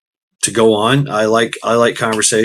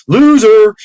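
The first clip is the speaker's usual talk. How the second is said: mock taunt; 10 semitones higher, 8 dB louder than usual. Mock insult to viewers.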